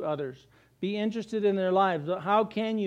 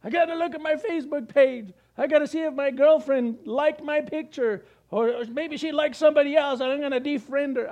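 put-on higher, louder, whiny-kid voice of a phone addict; phone-addicted youth; 7 semitones higher, 4 dB louder than usual. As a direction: play a teen who can't put his phone down, higher and louder. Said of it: "See how addicted we are to ourselves?".

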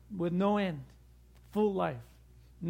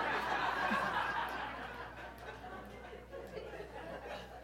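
long unvoiced laugh, much higher; high breathy laugh; 12 semitones higher, 9 dB quieter than usual. Real, not acted. Preacher cracks up at his bear joke, long breathy laugh. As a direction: crack up at your own joke.

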